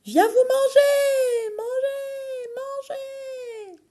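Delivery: high echo fading away. Acted. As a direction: let each repeat fade into echo. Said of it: "La voix d'Emma résonne par la cheminée".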